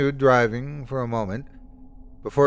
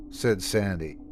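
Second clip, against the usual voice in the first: usual speaking voice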